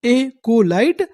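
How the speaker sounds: एकोलाइट drawn out with a rise; slow sounding out. Shown as a demonstration. Teacher answering his own question, slow. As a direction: stretch एकोलाइट out slowly. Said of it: "उसमें काम करने ... क्या बोलते हैं?".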